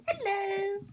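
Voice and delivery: high, loud playful greeting; squeaky high hello. Pretend. Greeting from murky river scene.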